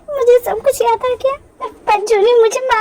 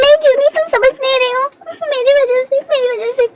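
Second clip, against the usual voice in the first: upset, insistent pleading; distressed self-blame; 3 semitones higher than usual. Acted. Woman insisting to Juni that she is to blame, distraught. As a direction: blame yourself, getting more upset.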